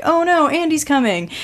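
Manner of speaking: a warning cry among toys, lightly acted; mock alarm. The toys warn each other that Andy is coming, mock alarm. Toys panic at owner approaching.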